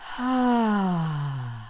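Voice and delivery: gentle, focused release of breath; focused sigh. Shown as a demonstration. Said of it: "here's two".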